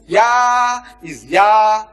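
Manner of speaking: "Yah" held very long, high and loud; drawn-out exclamation. Shown as a demonstration.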